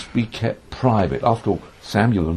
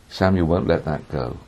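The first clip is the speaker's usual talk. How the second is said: usual speaking voice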